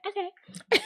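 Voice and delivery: high, sing-song brush-off; dismissive okay. Retold or imitated. Woman brushing off her ex, a high sing-song okay. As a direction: toss it off and walk away. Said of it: "And she's like".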